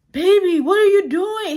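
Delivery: put-on high, loud go at Oscar's accent; attempted coworker's accent. Oscar coming back to scold a coworker, in an imitated accent. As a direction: burst out in a badly imitated accent. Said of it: "He used to do this accent. I can't really do it".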